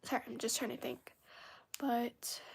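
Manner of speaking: soft whisper while gathering thoughts; hushed thinking aloud; whispered aside. Real, not acted. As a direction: whisper it to yourself, searching for words. Pausing to think mid-stream.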